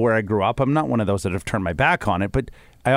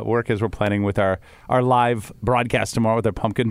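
ordinary talk, nothing marked

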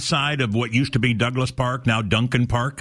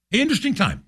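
a much higher, sing-song "interesting"; 11 semitones higher, faster than usual. Real, not acted. Host musing to listeners, high and sing-song. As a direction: savour the word "interesting" high up, then let it fall.

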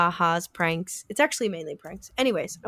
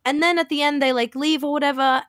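ordinary talk

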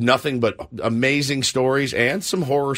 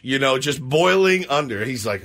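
ordinary talk

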